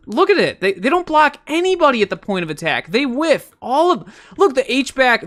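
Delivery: high-pitched, pleading complaint; worked-up, incredulous protest; exasperated rant. Real, not acted. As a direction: point out the failure in disbelief. Ranting about missed blocks.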